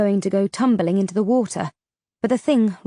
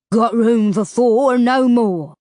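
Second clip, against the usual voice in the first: slow, drawn-out creature voice; slow ferryman rasp; 2 semitones higher, 5 dB louder, slower than usual. A narrator's reading. Ferryman Rubers promising passage, slow and menacing. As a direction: draw it out like a river monster.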